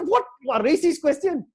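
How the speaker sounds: retold angry outburst. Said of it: "Wah, I get angry".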